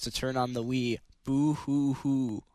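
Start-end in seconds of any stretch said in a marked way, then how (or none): 1.2-2.4 slow mock boo-hoo sobbing; fake crying. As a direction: pretend to cry over nothing. Mocking ten second wait.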